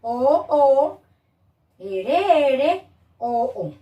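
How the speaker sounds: "O, O, R, R, O, O" named one by one; separate, deliberate O and R; sounding out letters. Shown as a demonstration. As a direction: spell out each matching sound with care. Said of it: "tanto en las vocales".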